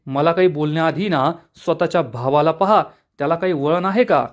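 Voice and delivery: ordinary delivery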